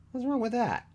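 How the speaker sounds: defensive. A narrator's reading. Dan defending himself after being called a moaner, high-pitched defensive. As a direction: shoot up in pitch, indignant.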